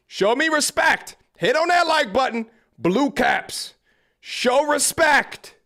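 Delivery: high, loud call to chat, slowing on the demands; rally the chat like a hype man; hype shout. Real, not acted. Streamer rallying chat to spam blue caps, shouting.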